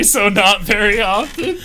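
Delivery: cracking up mid-quote; laughing. Retold or imitated. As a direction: squeal it through laughter. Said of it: "cut you off and say".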